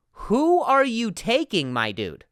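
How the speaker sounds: slow, punchy challenge to the listener; emphatic. Real, not acted. Host pressing podcast listeners, emphatic and slow. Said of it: "this is me being emphatic".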